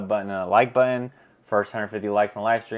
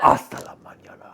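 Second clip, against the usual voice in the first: 1 whispered mock Spanish; 6 dB louder than usual. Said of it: "I have to sound like that".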